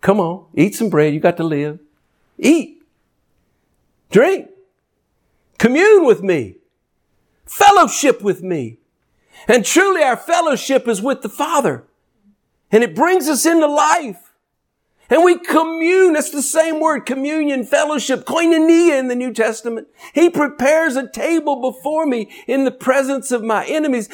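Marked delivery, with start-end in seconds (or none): 2.4-19.9 preaching at full force. Acted. Climactic preaching. 20.1-24.1 high, ringing preached psalm; preaching at full force. Acted. Preacher crying out the psalm to the church, high.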